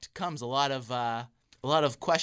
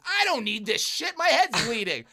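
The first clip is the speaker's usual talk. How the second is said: much higher, loud, slow angry snap; angry outburst; 10 semitones higher, 8 dB louder than usual. Retold or imitated. Injured friend snapping at his cousin, angry. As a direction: shove it away in anger.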